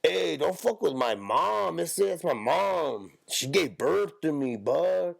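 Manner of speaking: put-on high, drawn-out Mexican-accented complaint. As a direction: stretch it slowly in a caricatured Mexican accent. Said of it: "They all sound like".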